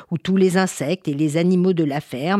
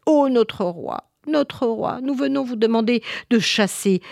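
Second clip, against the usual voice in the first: fearful plea; 6 semitones higher than usual. Acted. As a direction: implore the king for help. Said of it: "Tout le monde était apeuré".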